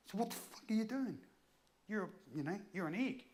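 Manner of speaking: high-pitched indignant challenge; retold angry outburst. Man rounds on the role-play woman, angry outburst. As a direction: re-enact losing the plot. Re-enacted losing the plot at woman.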